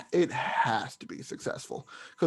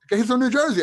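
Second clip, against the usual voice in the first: much higher and louder burst of surprise; amused surprise; 10 semitones higher, 7 dB louder, much faster than usual. Real, not acted. Podcaster reacting to his cohost's New Jersey quip with amused surprise. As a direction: react like the joke caught you off guard.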